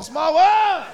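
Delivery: put-on high, loud kid chant; wild kids chanting the ride song; kids yelling. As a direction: yell it like a rowdy kid.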